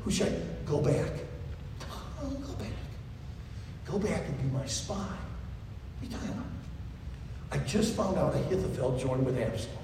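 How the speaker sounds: soft, low and quick; David calming Hushai, soft and low. Acted. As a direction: hush him down gently. David quietly instructing Hushai.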